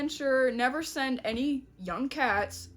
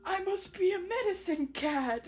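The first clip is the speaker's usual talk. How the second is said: higher, melodramatic renunciation; mock-solemn refusal; 6 semitones higher than usual. Medicine cat turning down her lover, high mock solemnity. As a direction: play duty over love, pitched up.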